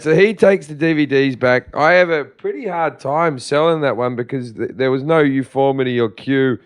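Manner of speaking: ordinary talk, nothing marked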